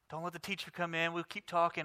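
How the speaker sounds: put-on hushed, fast kid chatter; second graders talking softly while the teacher is out; sneaky kids whispering. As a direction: keep it low so the teacher won't hear.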